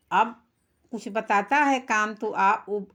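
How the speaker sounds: ordinary talk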